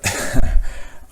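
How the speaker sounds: loud breathy burst of laughter; a short, voiceless laugh at bad luck; wry laugh. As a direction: huff out a rueful laugh. Wry turn before recounting setback.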